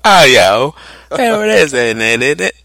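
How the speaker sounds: high, loud mock Cockney voice; British accent. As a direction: shout it like a cartoon Londoner. Mocking London accent banter.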